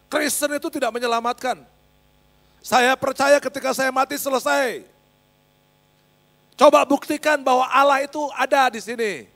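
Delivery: defiant challenge. Retold or imitated. Classmate challenging him to prove God exists, loud and defiant. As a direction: throw it out as a loud dare.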